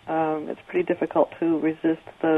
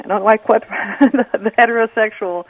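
real laugh mid-sentence, higher and louder; 5 semitones higher, 5 dB louder than usual. Real, not acted. Stumbling over the word for majority, laughing. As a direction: break into a chuckle while self-correcting. Laughs while correcting own wording.

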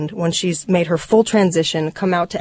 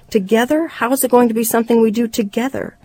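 higher-pitched disbelieving question; incredulous retort; 5 semitones higher than usual. Acted. Wife challenging husband's plea, incredulous retort. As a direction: throw the word back, pitched up.

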